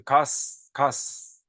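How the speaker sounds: "가스" ending in a long voiceless s; sounded final s. Shown as a demonstration. Teacher showing students the old final s, "가스" with a drawn-out hiss. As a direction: stretch the s of "가스" into a sustained hiss. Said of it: "스잖아".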